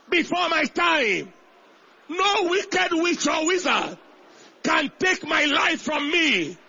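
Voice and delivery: high, loud, drawn-out declaration; audacious shout. Shown as a demonstration. Preacher leading the congregation in defiant confession, audacious shout. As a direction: shout it with audacity, stretching the last words. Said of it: "Say it with audacity".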